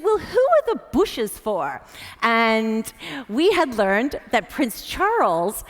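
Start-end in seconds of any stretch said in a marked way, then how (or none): 0.0-1.8 the Queen. Retold or imitated. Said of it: "and she said".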